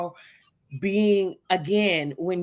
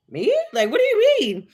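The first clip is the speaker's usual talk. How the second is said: much higher, louder, laughing disbelief; shocked disbelief; 7 dB louder than usual. Retold or imitated. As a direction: act floored by a stranger's compliment. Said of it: "completely shocked".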